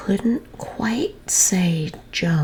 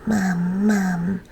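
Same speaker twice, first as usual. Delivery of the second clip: put-on baby voice; 3 semitones higher than usual. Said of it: "our funny baby was saying".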